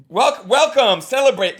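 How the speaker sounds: mock eager host. Coach imitating the comic's welcome, mock eager. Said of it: "You're like".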